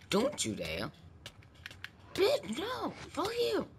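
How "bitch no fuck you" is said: squeaky shriek. Pretend. High squeak after dying.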